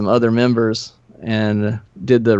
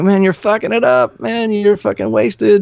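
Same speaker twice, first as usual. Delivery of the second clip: exasperated scolding; 10 semitones higher than usual. Retold or imitated. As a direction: tell him off, pitched way up. Scolding drunk bandmate before show.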